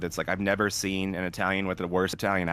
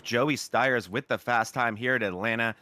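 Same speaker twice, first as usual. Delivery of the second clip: ordinary talk, nothing marked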